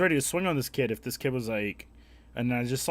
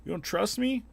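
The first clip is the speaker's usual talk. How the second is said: put-on high, louder whiny kid; kid's voice; 10 semitones higher, 4 dB louder than usual. Kid asking if he's not trusted, higher and whiny. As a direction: act hurt like a pestering boy. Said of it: "He's like".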